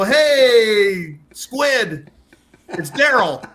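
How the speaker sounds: put-on hockey captain phoning his old pal; Daryl calling. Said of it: "they're gonna go".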